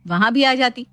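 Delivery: ordinary delivery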